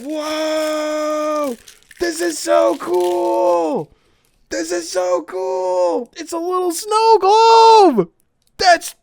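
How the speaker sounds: high, slow wondering exclamations; awed delight. Real, not acted. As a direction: marvel at the snow globe. Sees the snow globe.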